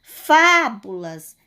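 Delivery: Fábulas with FÁ drawn out and loud; stress on first syllable. Shown as a demonstration. Teacher showing pupils the strongest syllable, FÁ stressed in Fábulas. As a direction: stretch and punch the FÁ in Fábulas. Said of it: "É a sílaba fá".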